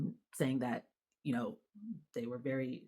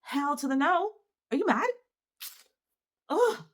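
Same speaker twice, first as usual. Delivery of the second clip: high, loud "hell to the no"; indignant outburst; 13 semitones higher, 9 dB louder than usual. Real, not acted. Mother rejecting the swim school's decision, loud and outraged. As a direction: throw it out in outraged disbelief.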